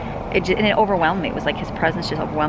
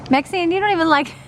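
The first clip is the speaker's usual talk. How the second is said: higher and louder, a dog telling off another dog; high scolding dog voice; 11 semitones higher, 5 dB louder than usual. Pretend. Ruby the dog scolding Maxine over her food, in a high loud voice.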